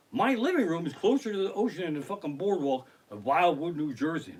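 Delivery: usual speaking voice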